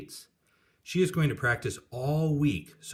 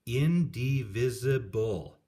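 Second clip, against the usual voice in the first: "Indivisible" drawn out slowly, syllable by syllable; teacher sounding out "Indivisible" slowly; slow teaching word; slower than usual. Shown as a demonstration. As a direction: spell out "Indivisible" slowly for the class. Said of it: "My teacher points to one of the words".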